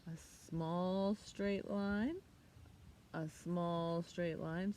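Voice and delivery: very slow stroke-by-stroke; slow demonstration. Shown as a demonstration. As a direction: stretch it out. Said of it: "teacher demonstrates".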